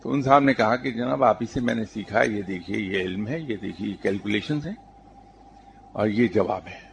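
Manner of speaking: usual speaking voice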